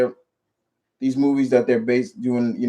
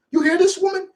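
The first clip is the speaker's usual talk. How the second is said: incredulous squeal; faster than usual. Retold or imitated. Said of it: "I'm like".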